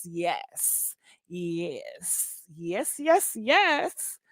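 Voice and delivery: very soft, low yes; savouring. Pretend. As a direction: murmur it low, pleased with yourself. Savouring own man voice.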